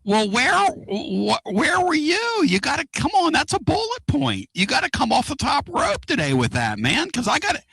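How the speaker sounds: squeaky, worked-up ribbing of a buddy; excited mock scolding. Real, not acted. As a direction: scold your co-host like he blew the big scoop. Scolds co-host for missing news.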